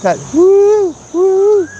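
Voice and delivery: high falsetto woo like the bird; bird call imitation. Vlogger copying a bird's call for viewers, high hooting. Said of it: "I can't do it".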